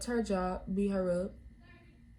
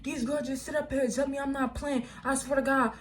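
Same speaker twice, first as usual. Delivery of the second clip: imitating an upset rant to Mom, pitched up; retold going off; 4 semitones higher than usual. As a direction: replay the outburst in a higher, contained voice. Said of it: "I'm just going off like".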